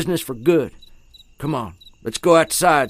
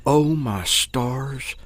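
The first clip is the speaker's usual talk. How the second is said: hushed awe; 4 semitones lower, 5 dB quieter, slower than usual. Acted. Old man realizes ghost.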